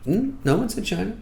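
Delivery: high, teasing hmm voice; coy commander. Retold or imitated. Comic commander coyly refusing to name the enemy. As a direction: tease them with a knowing hmm. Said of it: "He's like".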